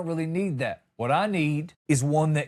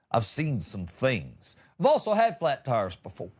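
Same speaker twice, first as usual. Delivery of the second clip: ordinary talk, nothing marked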